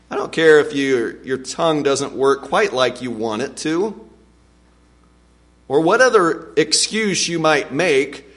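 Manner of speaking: usual speaking voice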